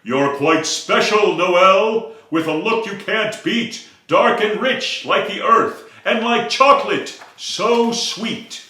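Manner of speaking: a big friendly sunflower paying a compliment; admiring sunflower, lifted and bright; giant sunflower voice. Acted. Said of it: "voice of a giant sunflower".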